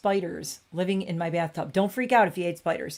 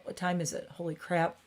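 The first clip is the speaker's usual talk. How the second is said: usual speaking voice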